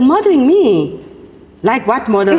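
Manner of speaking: loud, lifted disbelieving question; incredulous. Retold or imitated. Host told to try modeling, incredulous. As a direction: re-enact being taken aback.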